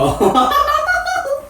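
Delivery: high-pitched fangirl cackle; delighted laughter. Acted. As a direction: burst into high, giddy laughter. Cousin laughs at video of idol.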